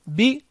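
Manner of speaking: ب as an isolated "bi"; kasra sound. Shown as a demonstration. Teacher reviewing letters with children, kasra sound. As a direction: say ب with an "i" vowel. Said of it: "بالكسر".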